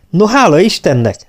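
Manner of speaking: high, lifted note of satisfaction; delighted and thankful; pleased relief. A narrator's reading. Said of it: "szólalt meg elégedetten".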